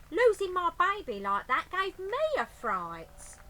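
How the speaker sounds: high, loud lady voice; eager agreement; Burglar Betty voice. Acted.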